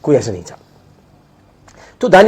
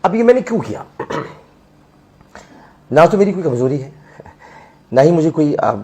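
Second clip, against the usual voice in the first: normal talking voice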